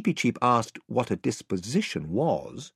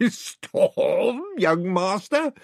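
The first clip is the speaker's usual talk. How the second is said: roaring sailor; 9 semitones higher, much slower than usual. Acted. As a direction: roar it like an amused old sea dog. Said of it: "he roared".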